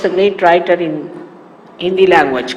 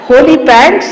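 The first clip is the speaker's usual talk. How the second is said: Holy pants called out louder and higher, rising on pants; exclaimed; 7 semitones higher, 7 dB louder than usual. Shown as a demonstration. Naming the punk shop item for the listener, loud and high. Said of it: "holy, H O L Y".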